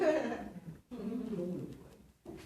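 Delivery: slow, high, laughing heckle; a hint of a whiny heckler; mock heckler. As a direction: drawl it out like a bored kid in the crowd. Crowd heckling the play.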